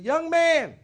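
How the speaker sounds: very high, loud insistent holler; yelled call. Retold or imitated. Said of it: "she just yelled out".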